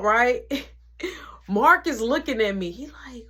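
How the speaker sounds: ordinary talk, nothing marked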